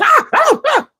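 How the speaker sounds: high-pitched mock shrieks; screaming girls. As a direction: imitate women squealing in a fight. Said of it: "You hear the girls".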